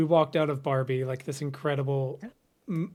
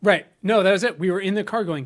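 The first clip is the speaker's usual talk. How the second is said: ordinary delivery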